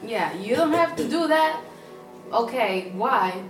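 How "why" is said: usual speaking voice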